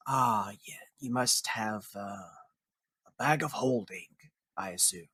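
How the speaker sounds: susurro ronco y grave de cocodrilo perezoso; raspy crocodile voice. Acted. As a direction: arrastra las palabras como un cocodrilo que se está refrescando. Crocodile voice again.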